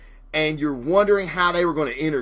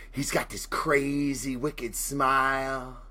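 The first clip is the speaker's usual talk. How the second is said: Joker imitation; 7 dB quieter, slower than usual. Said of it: "it's my Joker imitation".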